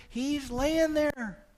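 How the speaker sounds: high, slow, drawn-out; drawn-out storytelling. Shown as a demonstration. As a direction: paint the picture slowly. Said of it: "Wind howling all over the place".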